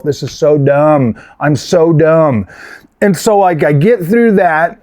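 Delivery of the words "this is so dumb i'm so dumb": slow self-reproach. Retold or imitated. Berating himself after scam call.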